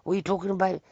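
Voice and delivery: high, fast, indignant comeback; mock indignant outburst; retold anger. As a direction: act out someone angrily taking offence. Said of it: "He got really angry".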